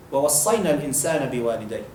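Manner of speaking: rapid Arabic verse recited; brisk Quranic recitation; quick Quran recitation. Acted.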